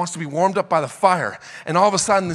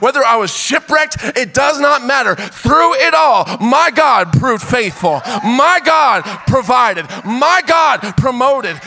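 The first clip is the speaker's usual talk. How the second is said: full-force preaching; 5 semitones higher, 3 dB louder than usual. Acted. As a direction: build it to a shouting climax.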